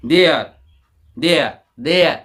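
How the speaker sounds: there said as "dea", with no r sound at the end; the teacher's model of there, with the r dropped; silent r. Shown as a demonstration. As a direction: say there without rolling the final r, "dea". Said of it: "आर का उच्चारण नहीं करते हैं ना".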